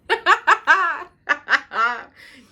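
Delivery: high, loud burst of laughter; real peals of giddy laughter. Real, not acted. About to sing herself happy birthday, laughing giddily.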